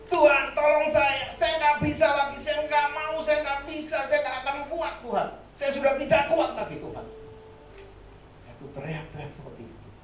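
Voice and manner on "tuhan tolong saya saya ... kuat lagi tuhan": much higher, loud desperate plea; crying out to God. Retold or imitated. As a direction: beg God for help at the breaking point. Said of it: "Saya teriak, minta tolong".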